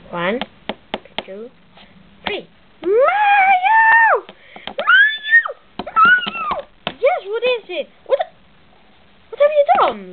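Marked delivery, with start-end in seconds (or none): 2.8-6.7 high, drawn-out yell of a name; piercing wake-up holler; shouting to wake someone. Acted. Waking up sleeping Mario by shouting. 6.9-10.1 high, dragged-out indignant cry; high-pitched protest; startled awake. Acted. Mario jolted awake, upset at Luigi.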